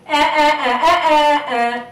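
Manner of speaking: high, loud teasing laugh; nyah-nyah laughing; mocking kid laughter. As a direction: jeer like giggling kids. Children laughing at cat.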